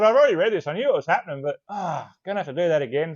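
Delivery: loud, high start easing into a groan; exasperated. Retold or imitated. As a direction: imitate someone fed up with himself. Retells narrator's exasperation.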